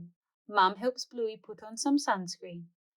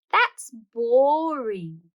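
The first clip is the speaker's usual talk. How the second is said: whiny bored; 3 semitones higher, 7 dB louder, much slower than usual. Acted. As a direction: drag it out, sulky and slow. Bluey refusing to brush teeth.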